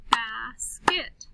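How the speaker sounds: BASKET broken into BAS and KET, slowly; slow, deliberate "Bas-ket"; split into syllables. Shown as a demonstration. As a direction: say "Basket" in two clapped beats. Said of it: "then I will clap the syllables".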